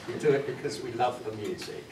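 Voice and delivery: usual speaking voice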